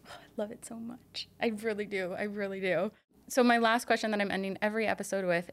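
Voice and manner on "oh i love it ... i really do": real breathy near-whisper of appreciation; moved, hushed. Real, not acted. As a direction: respond softly, touched by the words. Moved by the requested closing words.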